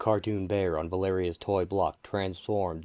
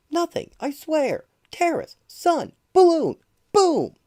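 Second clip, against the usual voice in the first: high loud protest; 11 dB louder than usual. Acted.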